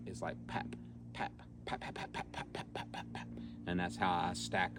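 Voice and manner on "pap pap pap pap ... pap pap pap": soft quick popped paps; staccato pap notes. Shown as a demonstration. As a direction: stack the paps in staccato rhythm. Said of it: "almost like pap".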